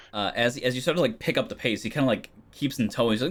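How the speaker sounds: ordinary talk, nothing marked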